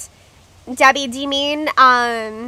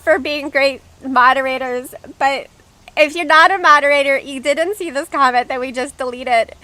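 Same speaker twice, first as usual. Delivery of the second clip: normal talking voice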